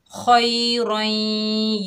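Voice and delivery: खोई रई stretched very slowly, heavy रा; slow tajweed demo. Shown as a demonstration. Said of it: "रा को मोटा पढ़ेंगे".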